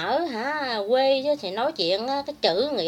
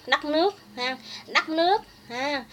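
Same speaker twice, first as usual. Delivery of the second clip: "đất nước" higher, in imitation Northern Vietnamese accent; mocked Northern accent; 5 semitones higher than usual. Speaker ridiculing rival's fake Northern speech, mocking Northern accent on "đất nước". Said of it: "mà kêu cái đất nước".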